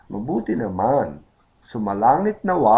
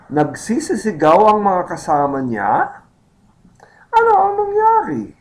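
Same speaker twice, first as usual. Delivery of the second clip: high, loud surprised questioning; 10 semitones higher, 6 dB louder than usual. Acted. Second man asks what happened in Tagalog, surprised. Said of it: "The second man is surprised".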